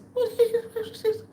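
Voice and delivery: high chattering cat voice; imitated cat noise. Cat reacting as the raven leaves, high chatter. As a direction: imitate a cat's high-pitched complaint. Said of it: "and the cat says".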